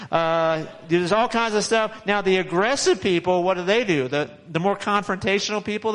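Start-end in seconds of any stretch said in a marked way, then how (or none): none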